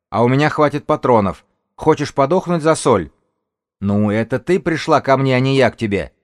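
loud, high, combative retorts; shouted argument. Acted. Rivals quarrel over salt from cover, loud shouting. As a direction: argue at full voice across the distance.

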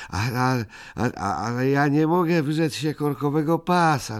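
high stammering whine; whining. Acted. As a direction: Ryjek whining he can't give up the belt. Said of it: "jęczał Ryjek".

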